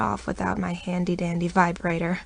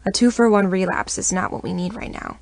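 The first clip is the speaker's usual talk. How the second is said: usual speaking voice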